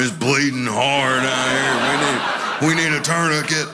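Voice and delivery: half-laughing mock emergency; mock panic. Homeowner in the basement mock-panicking over a leak. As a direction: play the hysteria for laughs.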